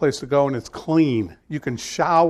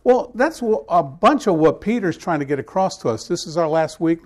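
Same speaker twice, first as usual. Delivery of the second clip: normal talking voice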